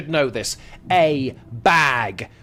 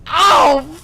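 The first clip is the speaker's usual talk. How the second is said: high, loud exclamation; 12 semitones higher, 8 dB louder than usual. Real, not acted. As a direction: burst out with a high cry. Reacts to Pokemon question.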